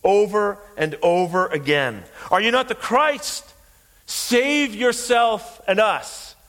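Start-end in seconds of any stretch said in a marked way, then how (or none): 2.3-6.4 preacher acting out a jeering shout; shouted taunt. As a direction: hurl it as a shouted mockery. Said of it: "He's shouting blasphemies".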